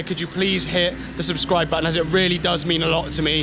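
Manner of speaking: ordinary talk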